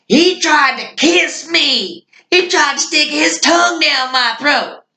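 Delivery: very high comic accusing yell; put-on high-pitched outraged complaint; imitated outraged drunk. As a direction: wail the accusation high to a cop.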